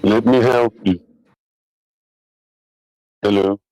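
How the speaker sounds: normal talking voice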